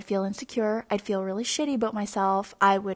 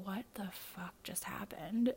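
muttered disbelief; 12 dB quieter than usual. Retold or imitated. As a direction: say it under your breath, stunned. Retelling boyfriend's stunned reaction.

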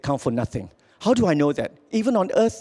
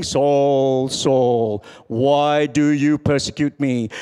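voice from heaven; 5 semitones lower, much slower than usual. Retold or imitated. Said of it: "he heard a voice from heaven saying".

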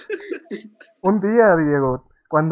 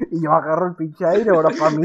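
normal talking voice

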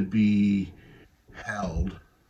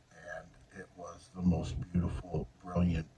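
softer, nearly whispered with emotion; hushed and moved; 3 semitones lower, 9 dB quieter than usual. Real, not acted. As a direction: let the awe hush your voice to almost a whisper. Moved, recalling God's love.

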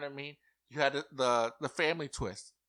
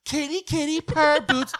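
much higher, loud squeaky cutesy voice; mock contestant voice; 10 dB louder than usual. To the casting producers, mocking Blue's diary room with a high cutesy squeal. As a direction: play a ditzy houseguest, way up high. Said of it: "If Blue Kim is talking like this".

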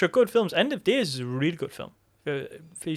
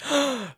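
sharp, high mock gasp of delight; excited gasp; 12 semitones higher than usual. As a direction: gasp as if suddenly thrilled. Acts out excited viewer reaction.

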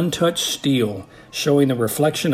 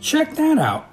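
put-on much higher kid voice calling attention; curious remark. A narrator's reading. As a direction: point it out in a high young voice. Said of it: "Kyle remarked".

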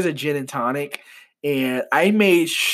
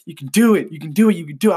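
pep talk building in energy; rallying self-encouragement; pumping oneself up; 3 semitones higher, 4 dB louder, much faster than usual. Retold or imitated. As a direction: psych yourself up, repeating it insistently. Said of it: "I was pumping myself up".

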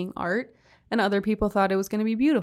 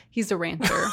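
ordinary talk, nothing marked

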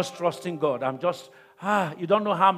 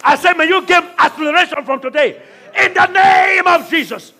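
higher and louder, slow, fervent shouted prayer; preaching at full force; 10 semitones higher, 11 dB louder than usual. Acted. Pastor to congregation, praying over them, shouted preaching at full force.

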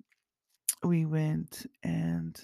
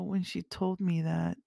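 ordinary talk, nothing marked

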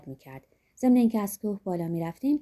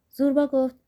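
ordinary delivery, nothing marked

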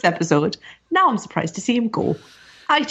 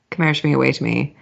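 quick, lower, hammed-up Irish accent; mock Irish accent; 8 semitones lower, much faster than usual. Co-host teasing Irish listeners, in a quick mock Irish accent. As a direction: toss off a playful fake Irish phrase.

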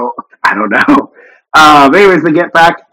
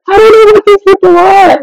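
high-pitched, louder panicked outburst; mock panic; 5 dB louder than usual. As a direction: freak out in an imitation of someone panicking. Acting out his freaked-out panic.

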